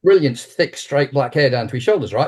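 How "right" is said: ordinary talk, nothing marked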